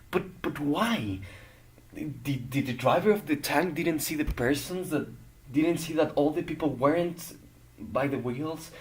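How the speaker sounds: high, distraught questioning; appalled, desperate demand to know why; anguished disbelief. Acted. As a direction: plead for an answer, voice rising high in disbelief. Distressed questioning of the tank deaths.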